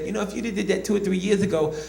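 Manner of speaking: polite threat in a high voice; Mike Tyson impression. Boxer answering the comic who imitated him, Tyson voice. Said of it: "he finally said".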